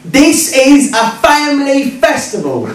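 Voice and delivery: slow, high-pitched mock outrage; emphatic indignant repeat. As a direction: spell it out slowly, scandalised. Heckler repeats it after his "What?.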